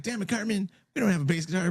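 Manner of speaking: high, exasperated cartoon kid; imitating Token. Quoting Token answering Cartman, high and exasperated. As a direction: squeak it out, fed up with Cartman. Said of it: "He's like".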